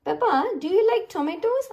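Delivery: high, lilting question; high-pitched questioning. A narrator's reading. Grandpa asking Peppa in the garden, high lively question. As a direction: ask it in a high, lively storybook voice.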